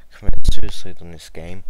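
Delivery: loud exasperated outburst; frustrated shout. Real, not acted. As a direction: blurt it out loud.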